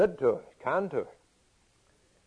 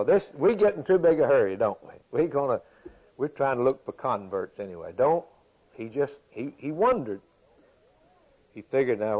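ordinary talk

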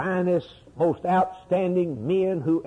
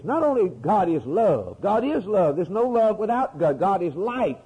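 ordinary delivery

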